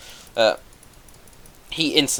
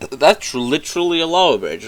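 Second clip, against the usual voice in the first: higher, louder put-on donkey voice; mock Donkey alarm; 6 semitones higher, 7 dB louder than usual. Kid voicing Donkey spotting the lava bridge, higher and louder. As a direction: gasp it out like a nervous donkey.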